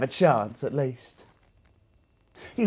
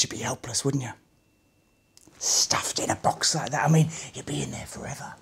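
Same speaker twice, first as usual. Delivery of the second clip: hushed fearful whisper; 5 dB quieter than usual. Acted. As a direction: whisper it as if trapped in the dark. Helpless in a box, hushed.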